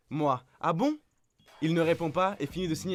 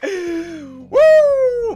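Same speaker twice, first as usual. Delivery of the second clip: laughing whoop; 14 dB louder than usual. Real, not acted. Streamer cracking up at the story, laughing high whoop.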